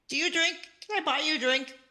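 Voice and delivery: tentative offer. Acted. Character shyly offers a drink.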